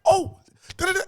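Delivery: high, thrilled squeals of recognizing him; high giddy fan excitement; excited recognition. Retold or imitated. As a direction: gush like a starstruck kid. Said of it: "And I was like".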